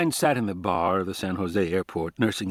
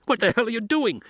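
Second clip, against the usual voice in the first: much higher, outraged yell; 12 semitones higher than usual. A narrator's reading. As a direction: yell it from the sand. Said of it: "Levine yelled".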